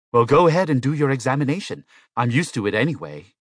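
a testy go-ahead, starting loud and easing off; exasperated patient. A narrator's reading. Patient grudgingly lets the machine examine him, exasperated.

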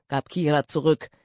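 ordinary delivery, nothing marked

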